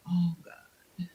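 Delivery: hushed whisper; whispered dread. Retold or imitated. Guest recalling her reaction to him, whispered dismay. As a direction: breathe it out in dread. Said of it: "And I just said".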